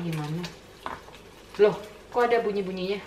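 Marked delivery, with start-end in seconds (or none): 1.6-3.0 surprised. Real, not acted. Surprised by fizzing in pot.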